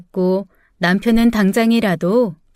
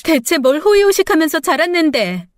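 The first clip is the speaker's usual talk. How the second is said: 높은 목소리로 따지는 딸; 어이없다는 듯 날카롭게 되묻기; 발끈해 맞받기; 6 semitones higher than usual. A narrator's reading. 딸이 아버지에게 맞받아치는 싸움. (높게 쏘아붙이는 성난 딸)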